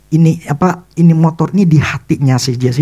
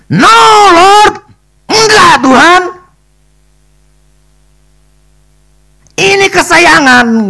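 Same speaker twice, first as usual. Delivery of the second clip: much higher, louder wailing refusal; crying protest; 7 dB louder, slower than usual. Retold or imitated. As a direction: wail it like a child losing a toy. Refusing God, crying over bike.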